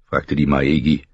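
ordinary delivery

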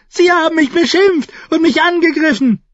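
high, loud, outraged screech; shrieking protest. A narrator's reading. Nephew on the ground complaining of the attack, shrieking. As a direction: shriek your grievance at the top of your pitch. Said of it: "kreischte Joffrey am Boden".

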